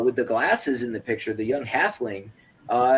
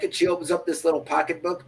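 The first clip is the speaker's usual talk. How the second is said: ordinary talk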